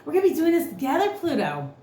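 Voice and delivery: high, eager voice for Mercury; chirpy Mercury. Pretend. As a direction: squeak it out like an excited little planet. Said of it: "And Mercury's like".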